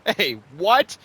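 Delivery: loud indignant bluster. Acted. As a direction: puff up and bluster. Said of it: "the Nord blustered".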